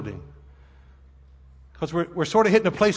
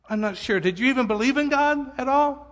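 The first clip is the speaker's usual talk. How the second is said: ordinary talk, nothing marked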